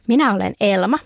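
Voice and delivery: plain reading voice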